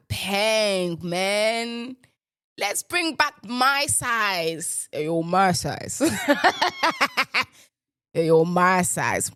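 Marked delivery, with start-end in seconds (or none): 6.1-7.4 high staccato cackle; laugh. Real, not acted. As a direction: break into a rapid, high laugh. Laughs at own slang.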